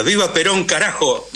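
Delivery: high-pitched mock rallying cry; retold shout. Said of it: "abre la puerta y grita".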